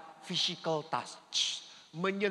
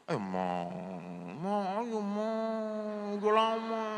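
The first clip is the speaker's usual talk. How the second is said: wheedling husband; 3 semitones higher, much slower than usual. Retold or imitated. Husband begging his wife.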